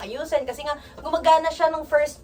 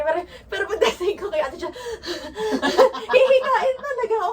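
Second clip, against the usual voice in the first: high laughter, words breaking through; laughing while telling how winded she was; 7 semitones higher than usual. Real, not acted. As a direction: laugh it out, breathless. Laughing about being out of breath.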